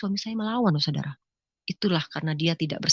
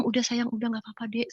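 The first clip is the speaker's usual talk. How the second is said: soft, tender hushing; gently dropped volume, consoling; soothing reassurance; 3 semitones higher, 6 dB quieter than usual. Retold or imitated. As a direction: comfort a crying younger sibling. Calming a crying younger sibling.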